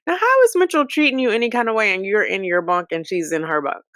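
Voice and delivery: ordinary talk, nothing marked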